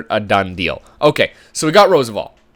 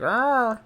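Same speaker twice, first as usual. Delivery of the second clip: high-pitched imitated dog whine; high, drawn-out dog noise; dog freaking out; 11 semitones higher, 4 dB quieter than usual. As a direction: imitate a dog's rising "arr". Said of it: "freaking out now. He's like".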